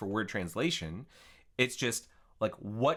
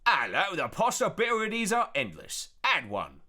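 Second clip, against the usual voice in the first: hammed-up bad Cockney, pitched high and loud; exaggerated fake Cockney chirp; 9 semitones higher, 5 dB louder than usual. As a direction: do a terrible over-the-top Cockney accent.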